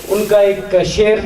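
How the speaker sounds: ordinary talk, nothing marked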